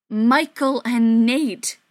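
slow and loud through gritted teeth. Acted. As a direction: grit your teeth and push it out. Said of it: "speaking through gritted teeth".

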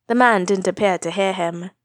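plain reading voice

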